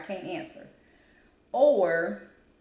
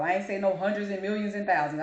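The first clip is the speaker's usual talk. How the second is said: ordinary talk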